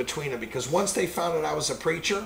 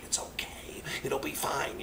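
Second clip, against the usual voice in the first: very soft, half-whispered reassurance; soothing; 12 dB quieter than usual. Retold or imitated. Retold husband soothing his wife.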